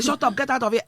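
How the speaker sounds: high, loud mock snap of a parent; a hammed-up parent shouting, half laughing; mock yell. Mother showing what she never says to her daughters, mock yelling. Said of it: "I don't say".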